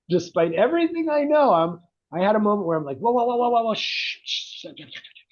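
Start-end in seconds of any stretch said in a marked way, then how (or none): 3.0-4.2 high, half-acted soothing of an upset kid; hushing a crying child. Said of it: "I'm trying to shut him down". 4.2-5.2 put-on soft whispered shh to calm a child; shushing. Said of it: "I'm trying to shut him down".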